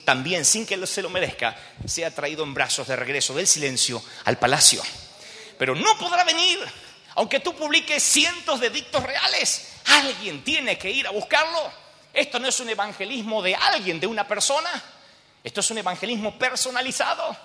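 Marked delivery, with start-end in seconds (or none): 5.6-17.3 high-pitched preacher at full force; impassioned preaching. Real, not acted. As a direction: drive each phrase home to the congregation. (prédica encendida, aguda y enfática)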